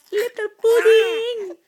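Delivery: high, loud, drawn-out pet name; loud fond cooing. Real, not acted. Parent teasing baby with a pet name, loud cooing.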